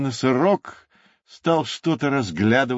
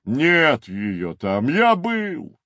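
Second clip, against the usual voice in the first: sighing rhinoceros; 6 semitones higher, much slower than usual. A narrator's reading. Rhinoceros tells the cub she is not there, sighing. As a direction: draw it out as a weary sigh. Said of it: "вздохнул носорог".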